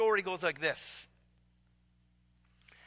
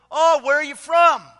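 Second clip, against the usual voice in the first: booming, much higher and louder hearty greeting; burly pastor; 6 semitones higher, 13 dB louder than usual. Retold or imitated. Burly pastor greeting the student at the door, booming and hearty. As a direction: boom it out like a jolly big pastor. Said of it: "he says to the young student".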